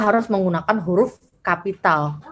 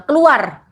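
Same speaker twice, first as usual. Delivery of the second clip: "Keluar" as a higher command with a falling end; exclamation intonation; 5 semitones higher, 4 dB louder than usual. Shown as a demonstration. Teacher demonstrating a classroom order, exclaimed. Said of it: "intonasinya pasti ketika dituliskan kan pakai tanda seru".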